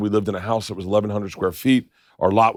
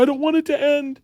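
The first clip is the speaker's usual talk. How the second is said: much higher imitated child's plea; little girl crying voice. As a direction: play a weepy eleven year old. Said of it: "She goes".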